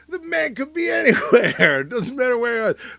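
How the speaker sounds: high, loud, breaking into a laugh; laughing mock Travolta. Host voices Travolta on the man, high loud with a laugh. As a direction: play it grandly, cracking up. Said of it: "And then he's like".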